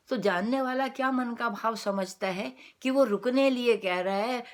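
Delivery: ordinary talk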